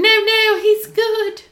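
high mock reassurance; lightly voiced naive villager; mock reassuring. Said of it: "And they're like".

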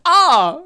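sudden loud, high "ah"; loud exclaimed ah. Acted. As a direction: burst out with a high shout. Reading out written exclamation marks.

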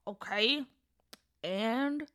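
talking through a mouthful; words round a bite of bread; mouth full. Acted. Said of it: "with a mouthful of bread".

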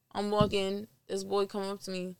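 ordinary talk, nothing marked

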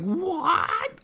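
a shocked What? shooting way up high; stunned disbelief, pitched high; mock surprise. Everyone stunned by the revelation.

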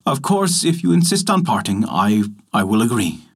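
usual speaking voice

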